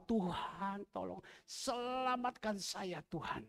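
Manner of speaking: soft, hushed pleading prayer; quiet prayer. Acted. Pastor leads the congregation in prayer, soft and pleading. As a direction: pray it gently under the breath.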